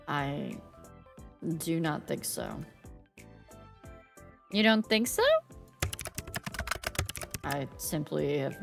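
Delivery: Grim Reaper voice. Acted. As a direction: aloof death god, calm and low. Death's game lines voiced.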